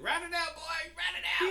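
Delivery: very high, loud cheering yell; hammed-up sideline shouting; mock coach yell. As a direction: bark encouragement at the boy like a coach. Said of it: "If I'm standing there, like".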